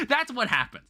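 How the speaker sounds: high giggle breaking into the line; laughing. Real, not acted. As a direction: laugh then say it. Laughs at the snake punchline.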